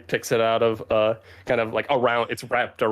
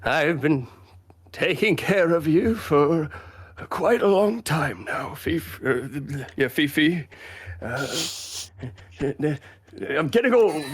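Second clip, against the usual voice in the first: put-on higher, halting old man's voice; elderly grandfather; 6 semitones higher than usual. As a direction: speak slowly as an aging grandfather, with long pauses. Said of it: "the grandfather Glan kind of approaches him".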